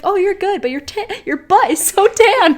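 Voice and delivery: high, loud laughing quote of the aunt; amused nurse quote. Retold or imitated. Aunt the nurse telling her niece her butt is tan, high and laughing. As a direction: deliver her verdict with a laugh. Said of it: "she's like".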